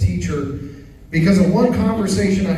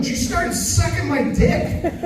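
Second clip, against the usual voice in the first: put-on innocent kid voice; 11 semitones higher, slower than usual. Make-believe kid's innocent punchline.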